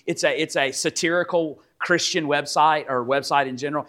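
ordinary talk, nothing marked

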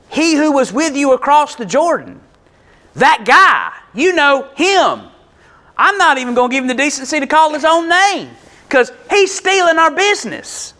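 high, emphatic disgruntled quote; indignant disdain. Acted. Disciple won't call Jesus by name, indignantly disdainful.

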